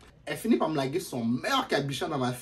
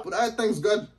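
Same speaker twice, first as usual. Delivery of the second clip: ordinary talk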